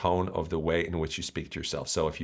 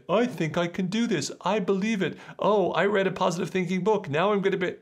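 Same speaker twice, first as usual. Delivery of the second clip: high, chirpy mock self-belief; mock positive thinker; 11 semitones higher than usual. Coach mocking naive positive thinking, high and chirpy. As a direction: parody someone fresh off a self-help book.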